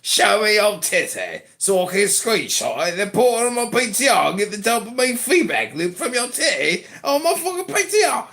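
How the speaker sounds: hammed-up British rant, pitch jumping up; put-on British accent. British comic rants at Lady Anne, hammed-up British accent. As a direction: rant like a crude British puppet character.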